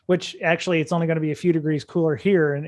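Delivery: ordinary talk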